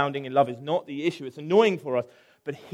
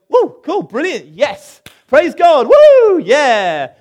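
higher and much louder, whooping with glee; hammed-up celebration, yelling with delight; overjoyed cheer; 10 semitones higher, 17 dB louder, slower than usual. Jonah as he should have reacted to Nineveh, mock ecstatic whooping. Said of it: "you think he goes".

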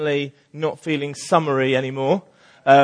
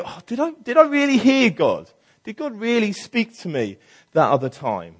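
doubting; 6 semitones higher than usual. Retold or imitated. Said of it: "the doubter".

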